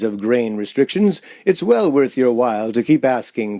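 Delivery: put-on lightly done old-time radio ad read; radio announcer. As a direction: sell the beer like a forties announcer. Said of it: "That was the announcer".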